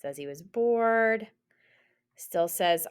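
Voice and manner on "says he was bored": usual speaking voice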